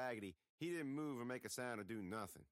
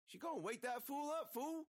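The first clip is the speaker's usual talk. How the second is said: sass him with a squeaky voice; Maynard answering. A narrator's reading. Maynard answering Jimmy, a high sassy voice.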